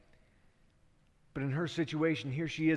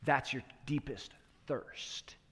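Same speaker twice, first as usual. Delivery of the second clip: soft whisper; 2 semitones lower, 5 dB quieter, slower than usual. Acted. Jesus names her wound.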